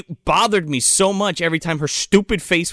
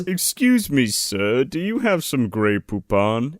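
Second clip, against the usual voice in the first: slow, mannered British request; lightly hammed-up British accent; mock British gentleman; 2 semitones lower, slower than usual. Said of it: "Haha, you're British joke".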